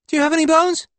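put-on tiny squeaky boy, very high and loud; high-pitched child. A narrator's reading. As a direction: pipe up in a piercing little-kid squeak. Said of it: "a high-pitched voice replies".

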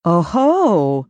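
pleased, pouncing exclamation; a triumphant caught-you aha; exclaiming. A narrator's reading. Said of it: "she exclaimed".

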